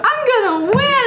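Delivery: very high, singsong cartoon glee; gleeful boast. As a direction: crow it in a squeaky mock-girlish voice. Voices Usagi boasting about the contest.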